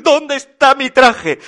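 high, loud, slow wail; distraught demand. A narrator's reading. Papá Noel searching for his suit, loud distraught wail. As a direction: call out in dismay.

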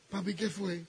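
soft, timid little question; fearful. Retold or imitated. Wife fresh from the bath asking husband, timid and soft. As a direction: ask meekly, afraid of the answer. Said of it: "Así, toda temerosa".

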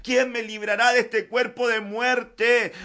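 loud, ringing pulpit voice; preaching at full force. Acted. Preacher urging the church to depend on the Lord, full force. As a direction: preach it out over the music.

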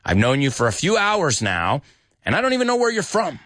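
exasperated sarcasm, climbing high and speeding up; acted sarcastic grievance. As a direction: build to a high, rushed complaint. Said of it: "He was being sarcastic".

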